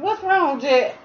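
high, coaxing pet talk. Real, not acted. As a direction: coo up high at the worried dog. Worried owner asks whimpering dog.

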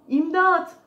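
loud, high-pitched cry for help. A narrator's reading. Unseen voice calling to the mouse, loud cry for help. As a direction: call out urgently for rescue. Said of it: "diye bir ses duydu".

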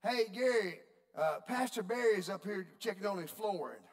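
loud, high call over the intercom. Retold or imitated. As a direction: page Gary across the whole store. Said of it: "This is what she says".

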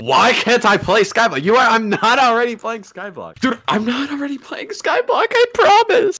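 high frantic loud protest; shrieking jackpot winner. Real, not acted. Freaking out in game.

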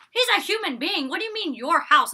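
high, loud indignant protest. Acted. Recapper objecting to the bully's house rule, indignant.

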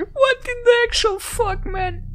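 very high squeal through real laughter; cracking up, voice shooting way up; laughing disbelief. Real, not acted. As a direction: squeak it out mid laughing fit. Laughing in shock at the game.

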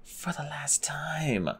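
slow, soft despairing whisper; hopeless whisper. A narrator's reading. Clay losing the fight, hopeless whisper. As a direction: fade out, defeated.

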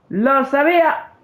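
shouting that he knew it all along; exclaim. Real, not acted. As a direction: shout it out, triumphant and annoyed. Exclaiming he was right.

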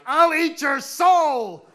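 high, loud, slow demonic howl; hammed-up monster roar, vowels stretched out; soul-eating monster. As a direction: bellow it like a comic demon. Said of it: "to me sounded like".

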